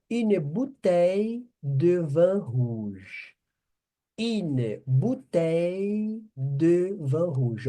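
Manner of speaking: une bouteille de vin rouge slowly stretched; slow demo. Shown as a demonstration. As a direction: model it slowly, twice. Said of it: "teacher models phrase".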